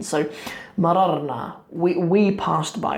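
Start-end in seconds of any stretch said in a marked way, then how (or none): 1.7-3.0 slow, with we leaned on; slow gloss, we emphasized; stress on we. Shown as a demonstration. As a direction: put weight on the first we, then passed by. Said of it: "the na on the end is saying we were the ones".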